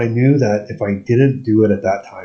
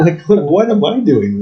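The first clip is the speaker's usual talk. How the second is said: laughing disbelief; 8 semitones higher, 4 dB louder, slower than usual. Real, not acted. Laughing self-mockery.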